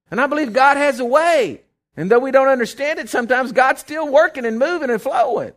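high, forceful declaration of faith; impassioned preaching. Real, not acted. Preacher defending stirring people's faith, impassioned preaching. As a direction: preach it boldly, pitched high.

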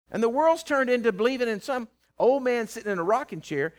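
ordinary talk, nothing marked

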